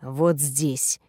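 low, soft hoarse whisper; raspy whisper. Acted. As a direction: breathe it out low and rough. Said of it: "Хриплый шёпот сводит с ума".

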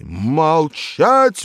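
high, loud bellowed command; loud furious yell; roar. A narrator's reading. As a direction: roar it to shut him up. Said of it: "взревел заведующий".

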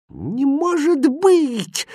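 very high, drawn-out disbelief; amazed exclamation. A narrator's reading. Princess seeing the empty chest, exclaiming. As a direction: squeal it slowly in astonishment. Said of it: "воскликнула принцесса".

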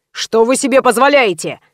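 mildly indignant exclamation. A narrator's reading. Man in the queue rebuking a rude stranger, indignant. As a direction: protest with restrained outrage. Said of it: "с возмущением воскликнул".

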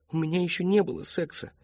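put-on high young voice; daughter's protest. A narrator's reading. Daughter protesting to her father, high pleading voice.

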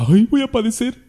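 a high, hammed-up whimper of fake dread; teasing the flock with a mock fearful cry; mock frightened believer. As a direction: play a scared churchgoer for laughs.

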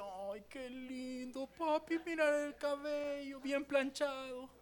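put-on high, soft, slow little girl's coo; imitating his daughter. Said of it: "mi hija decía".

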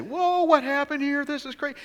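high, loud amazed whoa; astonished bystander. Pretend. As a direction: gape at a talking donkey. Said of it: "I'd say".

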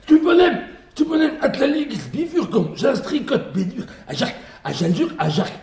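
high, fast nonsense babble; raving gibberish. Acted. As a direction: rattle off laughing gibberish.

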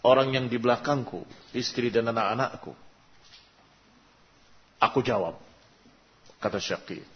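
usual speaking voice

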